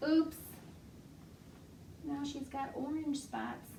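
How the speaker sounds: animated library storytime voice; reading to children. Acted. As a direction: tell it like a picture book for toddlers. Reads flannel story to children.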